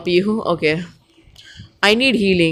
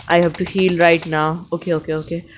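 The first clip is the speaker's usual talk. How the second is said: ordinary delivery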